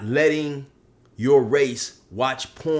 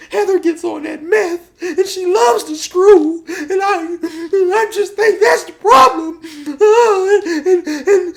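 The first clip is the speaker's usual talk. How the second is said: falsetto mock sobbing building to a wail; Josh; 11 dB louder than usual. Josh despairing over Heather, exaggerated weeping climbing to a wail. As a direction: break down completely, squeaky and hammed-up. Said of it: "Josh is crying and stuff".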